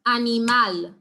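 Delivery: loud, firm "Animal", landing on MAL; stress on MAL. Shown as a demonstration. Teacher snapping along for the class, "Animal" stressed on MAL. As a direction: drill a-ni-MAL, punching the final syllable. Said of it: "snap our fingers ... that is stressed".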